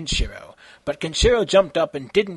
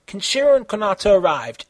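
usual speaking voice

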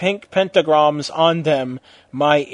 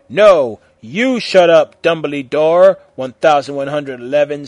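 loud retort; 8 dB louder than usual. A narrator's reading. Tom yells back.

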